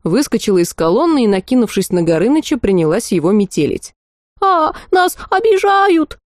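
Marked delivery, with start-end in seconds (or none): 4.4-6.2 very high, slow, odd shriek; the dragon squealing it's being bullied, very high. A narrator's reading. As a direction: shriek like a wounded many-voiced serpent. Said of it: "заверещал змей разными голосами".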